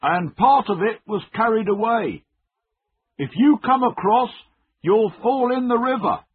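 high, loud call across a river; urgent, high-pitched calling out; shouted warning. A narrator's reading. Man shouting warning across bridge.

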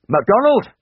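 high, loud roll-call shout; shouted name. A narrator's reading. Airman shouting a laundry name to a waiting crowd, high shout. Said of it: "shouted the name on the".

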